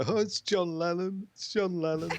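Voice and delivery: high, slow imitation of a fan; pestering fan. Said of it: "he kept saying to me".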